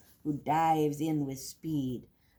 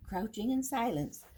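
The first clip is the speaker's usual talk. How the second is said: ordinary delivery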